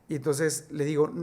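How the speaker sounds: normal talking voice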